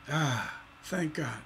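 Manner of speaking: soft, low "Ah" of relief; relieved sigh. Acted. Teacher playing the listener once the dangler resolves, quiet relief.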